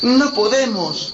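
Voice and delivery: put-on high, slow child's voice; high little Gretel, slow and pleading; little girl voice. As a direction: speak like a small frightened girl. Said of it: "añadió Gretel".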